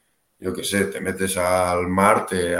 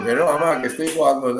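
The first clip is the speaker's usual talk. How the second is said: protesting son; 7 semitones higher than usual. Retold or imitated. Hijo responde a su madre.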